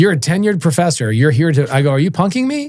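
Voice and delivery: incredulous, pitch climbing high at the end; rising, baffled protest; retold disbelief. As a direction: act out suspecting a prank. Disbelief at professor seeking a job.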